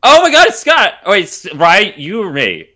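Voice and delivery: normal talking voice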